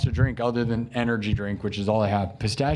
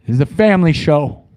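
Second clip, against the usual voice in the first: mock indignant; 8 dB louder than usual. Mock indignant after innuendo.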